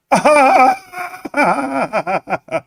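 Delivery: drawn-out high fake cackle; mocking laugh. Streamer mocking AMC losers with a long fake cackle. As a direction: cackle long and hard at them. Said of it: "are those real laughs".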